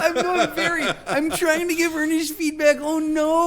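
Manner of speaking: high, mock-pleading voice; hammed-up sincere dismay; earnest protest. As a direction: mimic a misunderstood do-gooder. Mimics the misunderstood earnest coworker.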